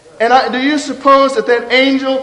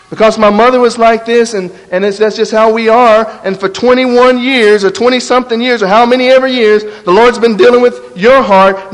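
louder, driving sermon delivery; rising preaching; 5 dB louder than usual. Acted. Preacher urging the congregation toward honesty, loud and driving. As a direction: preach it home with growing force. Preacher builds to full force.

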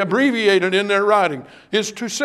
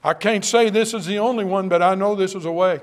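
usual speaking voice

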